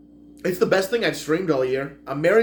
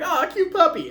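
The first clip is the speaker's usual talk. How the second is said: high, louder squeal of puppy delight; cooing delight; 5 dB louder than usual. Retold or imitated. Reviewer reacting to a puppy as the movie ends, cooing. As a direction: coo over something adorable, high-pitched.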